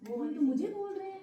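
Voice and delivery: mock loud call from the guru; retold loud call. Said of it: "तो इतनी जोर से गुरुजी बोले".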